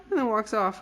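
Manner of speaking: ordinary delivery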